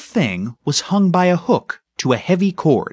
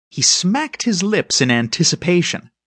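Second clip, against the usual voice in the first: ordinary delivery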